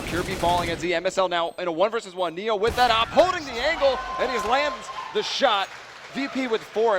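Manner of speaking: esports caster. Real, not acted. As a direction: build to a roaring call on the shot. Caster hypes clutch sniper shot.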